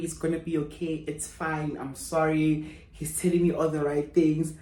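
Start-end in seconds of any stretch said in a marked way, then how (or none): 0.0-2.8 soft, low, soothing reassurance; imitating a tender consoler; consoling. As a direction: comfort someone who is crying, gently. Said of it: "He's busy consoling me".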